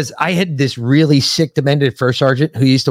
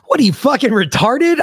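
high raised mock yell; mock yelling sergeant; 10 semitones higher than usual. Sergeant major yelling at the private. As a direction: bark it in disbelief. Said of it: "And he goes".

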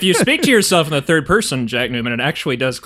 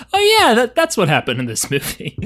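sudden recognition; 8 semitones higher than usual. Retold or imitated. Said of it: "I was like".

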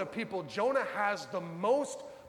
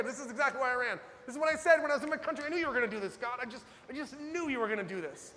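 exasperated; 5 semitones higher, faster than usual. Retold or imitated. Jonah voiced, exasperated at God.

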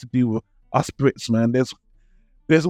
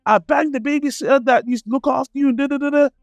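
mock confrontation; 10 semitones higher than usual. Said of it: "He's like".

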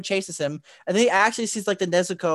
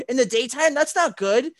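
high, louder alarmed Tanjiro; alarmed question; 7 semitones higher, 5 dB louder than usual. Retold or imitated. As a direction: voice his worry that she's out in daylight. Tanjiro alarmed at Nezuko outside.

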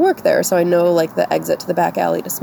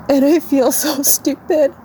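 slow, high, real crying voice; tearful self-reproach; 7 semitones higher than usual. Real, not acted. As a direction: admit it through tears, drawn out. Crying, ashamed of her reaction.

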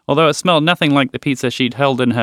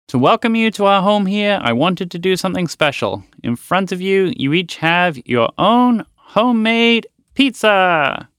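moose voice; 6 semitones higher than usual. Acted. Moose presents dinner.

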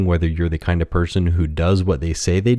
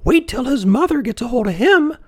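very high-pitched scolding villager; high-voiced village busybody; another villager. A narrator's reading. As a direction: threaten it in a high character voice. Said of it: "added yet another".

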